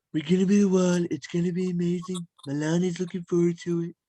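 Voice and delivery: boastful politician impression; change the timbre into a bragging president's voice; Donald Trump. Host gives guest an impression to guess, boastful politician voice. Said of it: "Well, Donald Trump".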